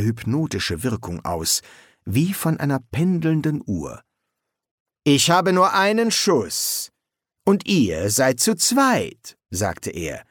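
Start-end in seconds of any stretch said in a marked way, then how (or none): 5.0-9.4 slow, high-pitched threat; menacing. A narrator's reading. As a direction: spell out the threat slowly. Crossbow threat to two riders.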